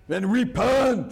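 slow booming biblical prophet voice; Charlton Heston impression. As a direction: thunder it like a movie prophet. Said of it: "it's like Charlton Heston".

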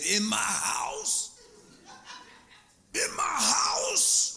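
slow high shouting, building; going off at her in church. Retold or imitated. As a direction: shout it out slowly, rising to a cry. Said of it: "I went off".

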